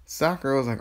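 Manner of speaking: usual speaking voice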